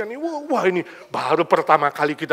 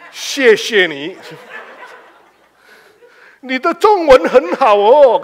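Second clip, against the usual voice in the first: slow, loud, careful Mandarin with a laugh; Mandarin phrases; 7 semitones higher, 11 dB louder, slower than usual. Retold or imitated. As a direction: pronounce the Mandarin slowly and proudly. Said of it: "Dia ngomong Chinese".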